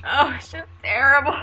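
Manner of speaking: hammed-up wail of misery; mock despair. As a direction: overplay it as a fake tragedy. Mock of the expected miserable reply.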